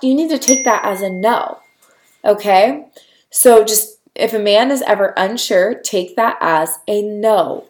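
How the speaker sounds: ordinary talk, nothing marked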